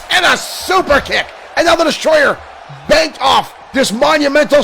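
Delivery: high, fast, shouted match call; excited play-by-play. Pretend. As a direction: call the action as it happens. Live wrestling play-by-play.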